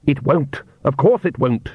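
ordinary delivery

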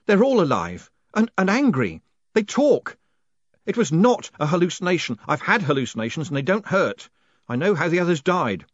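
high, frantic, panicked pleading. A narrator's reading. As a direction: blurt it out in panic. Moist panicking after attack.

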